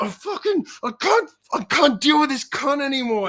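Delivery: high-pitched mock rant; hammed-up fed-up venting; whine-rage like a mate who's had enough; imitated raging. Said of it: "just raging".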